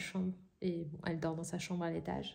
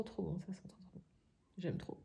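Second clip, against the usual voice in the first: whispering; 10 dB quieter, faster than usual. Real, not acted. Sniffing the candle, murmured.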